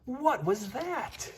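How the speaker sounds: high, drawn-out kitten voice; amazed question. Acted. Kitten wondering at his reflection, high and slow. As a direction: ask it slowly, wide-eyed.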